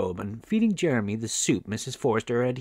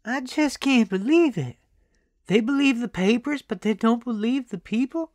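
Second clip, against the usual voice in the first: put-on high-pitched, distressed old lady; lamenting grandmother; 12 semitones higher, 3 dB louder than usual. A narrator's reading. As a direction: pour out your grandson's misfortune, high-voiced. Old woman laments grandson's accident.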